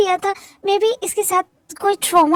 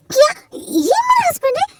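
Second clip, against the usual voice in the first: high-pitched, astonished question; stunned disbelief; 8 semitones higher than usual. Acted. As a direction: blurt it out in shocked disbelief. Learns a stranger is her husband. (हैरान, अविश्वास भरी आवाज़)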